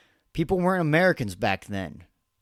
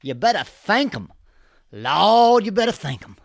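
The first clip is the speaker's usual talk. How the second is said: louder, preachy mock rally cry; mocking Kamala's Detroit voice; 5 semitones higher, 8 dB louder than usual. Podcaster mocks Harris's Detroit speech, loud preachy imitation. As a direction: ham up a politician pandering to a crowd. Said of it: "Let's see if she sounds any different".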